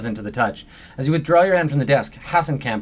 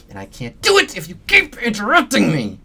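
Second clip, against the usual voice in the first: irritated rant; 7 semitones higher than usual. Acted. Techie berates intruder.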